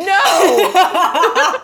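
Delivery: building to a high, loud, drawn-out NO; slow build into an exasperated shout; mock outraged cry. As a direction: wail the last word in comic dismay. Mock outcry at poem's character.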